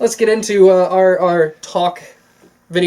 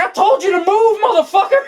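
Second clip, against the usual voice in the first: much higher, laughing shout; mock shout. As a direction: yell it and crack up. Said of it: "he's like".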